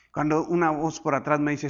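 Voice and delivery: usual speaking voice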